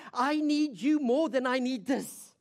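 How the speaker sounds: high, loud, emphatic plea; acted fervent confession; fervent declaration. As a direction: declare it with urgent devotion. Voices the woman's offering plea.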